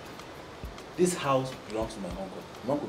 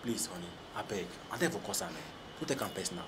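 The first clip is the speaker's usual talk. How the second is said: soft pleading; 4 semitones higher, 12 dB quieter than usual. Acted. Pleading softly after threat.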